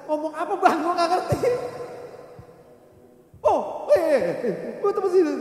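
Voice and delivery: high, loud comic protest; hammed-up flustered yelling; acted baffled reply. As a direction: shout back in confusion. Acted shouted exchange with attendant.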